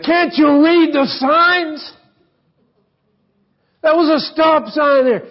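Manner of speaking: high, put-on angry driver voice; road rage yelling. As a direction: yell at the other driver, high.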